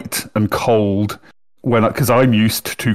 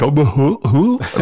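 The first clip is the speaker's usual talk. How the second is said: mock goofy cartoon-dog hoot, pitched up; 4 semitones higher, much slower than usual. Shown as a demonstration. Speaker mocking how their recorded voice sounds, cartoon dog. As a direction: hoot like a dopey cartoon dog, rising higher. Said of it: "I sound like a fucking cartoon dog".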